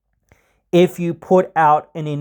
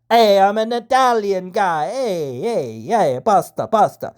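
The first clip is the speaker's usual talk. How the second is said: hammed-up Italian accent, pitched higher; 5 semitones higher than usual. Wrestler mocking a one-note Italian character, hammed-up Italian accent. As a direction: play a cartoon Italian guy in an Italian accent. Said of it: "you're not just a stereotype".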